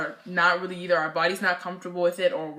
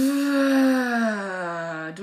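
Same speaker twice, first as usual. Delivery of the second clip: long, drawn-out reluctant sigh; mock-weary exhale, stretched out; 4 semitones higher, 3 dB louder than usual. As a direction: heave a big sigh at the thought. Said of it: "in my head I was like".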